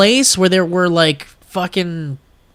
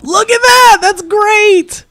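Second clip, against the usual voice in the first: high-pitched delighted outburst; excited exclamation; 4 dB louder than usual. Real, not acted. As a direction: exclaim it way up high, thrilled at the photo. Sees friend's photo put up.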